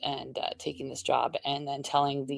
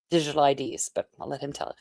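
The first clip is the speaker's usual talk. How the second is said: ordinary delivery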